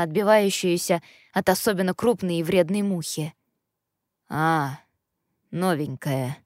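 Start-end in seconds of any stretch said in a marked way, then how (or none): 4.3-6.4 low, unimpressed drawl; drawled with a wince. Acted. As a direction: drag it out, clearly displeased. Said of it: "протянул господин Медный, чуть поморщившись".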